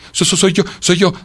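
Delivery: high, stuttering answer; shivering stammer. A narrator's reading. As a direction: push the words out through chattering cold. Freezing cicada finally gets words out.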